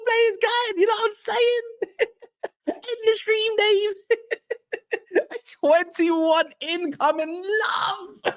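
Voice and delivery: high-pitched words through laughter; laughing. Real, not acted. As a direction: giggle while getting it out.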